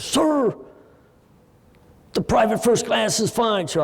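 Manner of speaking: high, tense voice of a frightened recruit; scared young private. Retold or imitated. Frightened private answering his captain, high and stiff. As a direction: snap to attention, voice pitched high with nerves.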